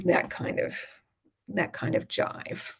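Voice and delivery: ordinary delivery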